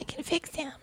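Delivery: soft mocking whisper. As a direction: hush it like a lovestruck secret. Echoing naive girl's thought.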